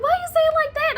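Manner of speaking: high, loud alarmed "why you say it like that"; alarmed protest. Retold or imitated. Telling the doctor off for sounding unsure, high alarmed protest. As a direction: shoot it back, startled and high. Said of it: "I was like".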